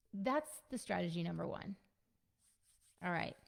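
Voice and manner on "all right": ordinary talk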